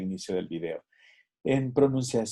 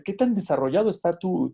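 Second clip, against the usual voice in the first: normal talking voice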